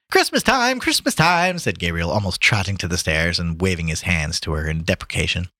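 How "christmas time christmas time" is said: high, insistent wave-off; brushing off protest. A narrator's reading. Gabriel to the girl while trotting to stairs, high deprecating call. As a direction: wave the protest away in a raised pitch.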